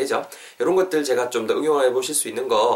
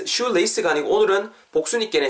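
ordinary talk, nothing marked

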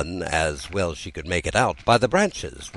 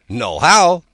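sharp, loud, higher snap of a word; brisk cry; 10 semitones higher, 10 dB louder than usual. Acted. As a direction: bark it out briskly and shut up. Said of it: "Tweedledum cried out briskly".

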